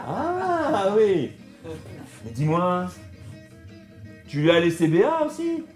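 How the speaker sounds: normal talking voice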